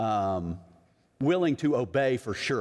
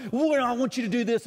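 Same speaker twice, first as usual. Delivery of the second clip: mock parental yelling; 8 semitones higher than usual. Parents talking to their kids, mock irate yelling. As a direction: imitate an irate parent without really yelling. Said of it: "they're yelling and screaming".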